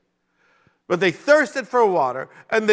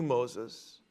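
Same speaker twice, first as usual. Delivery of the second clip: normal talking voice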